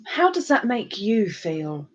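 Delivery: a hint of a slow, soft therapist; mock psychiatrist. Streamer joking she's a psychiatrist, soft slow therapist question. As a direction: ask it like a gentle therapist, half joking. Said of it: "I'm here as a psychiatrist".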